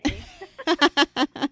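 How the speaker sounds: playful guest shows off a hammed-up Rochester accent; mock Rochester accent. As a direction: toss out a joking Rochester "eh?". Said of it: "I'll try to use my best Rochester accent".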